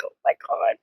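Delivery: whispered gasp of awe; whispered swoon; breathless whisper. Retold or imitated. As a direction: hush it like she can't breathe. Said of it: "I'm just like".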